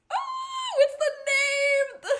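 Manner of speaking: very high, loud, drawn-out whine; frustrated wail. Real, not acted. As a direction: wail at the forgotten name. Frustrated at blanking on name.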